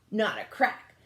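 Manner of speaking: usual speaking voice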